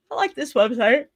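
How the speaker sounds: mid-yawn, words stretched. Real, not acted. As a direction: talk through a yawn. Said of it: "Sorry, I keep yawning".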